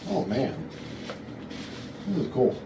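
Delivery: normal talking voice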